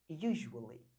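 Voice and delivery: teacher repeats usually; model pronunciation. Shown as a demonstration. Teacher repeats the word for students, low falling model. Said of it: "Diga lá comigo".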